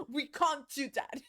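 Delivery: ordinary talk, nothing marked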